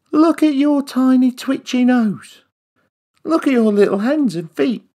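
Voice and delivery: high teasing voice; mocking. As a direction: tease him from up high. Mocking the mouse.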